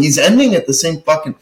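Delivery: normal talking voice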